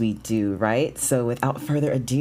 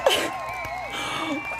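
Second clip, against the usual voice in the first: high delighted squeal of laughter; shrieking laugh; 5 dB quieter than usual. Real, not acted. Streamer laughing at her own launch, high giddy shriek.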